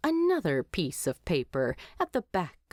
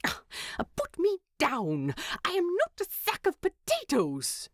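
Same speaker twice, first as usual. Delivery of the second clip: a touch higher, mildly indignant hobbit; indignant protest; 11 semitones higher than usual. Acted. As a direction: squirm and object, lightly voiced. Carried off, protesting loudly.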